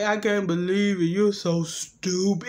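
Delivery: slow, stretched-out imitation; talking funny from gas. Gassed woman in disbelief at him, slow funny talk. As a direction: slur it slowly, drawing out the last word. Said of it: "talking 'cause the gas had overtook him".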